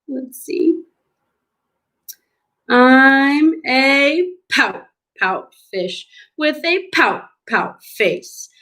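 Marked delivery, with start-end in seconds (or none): 2.7-8.5 stretched-out, sing-song storybook lament; gloomy pout-pout fish. Acted. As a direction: drag the opening, then sink through the blubs. Acts the fish's refrain.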